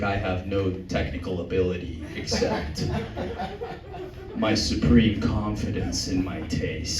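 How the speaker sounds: low, slow, hushed guru; Rick Rubin. Retold or imitated. Producer boasting about his taste, in a low slow voice. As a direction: drawl out a low, slow mystic confidence. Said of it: "Call me Rick Rubin".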